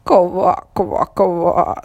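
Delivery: loud croaking laugh. A narrator's reading. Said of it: "засмеялась лягушка".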